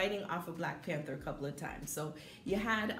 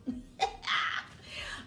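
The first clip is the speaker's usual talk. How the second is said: real short laugh after singing. Real, not acted. Reviewer amused by own singing, short laugh. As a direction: burst out laughing at yourself.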